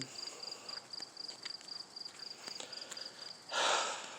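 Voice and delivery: a long, soft, breathy sigh out; long heavy sigh. Real, not acted. Mother worn out by her son, sighing. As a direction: let it all out in one tired exhale. Weary end of venting.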